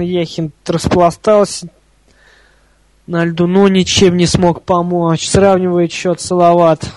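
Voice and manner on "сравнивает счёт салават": normal talking voice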